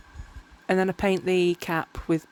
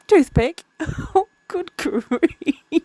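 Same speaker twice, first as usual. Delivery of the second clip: laughing; 10 semitones higher, 6 dB louder, much slower than usual. Real, not acted. Laughing at her own mix-up.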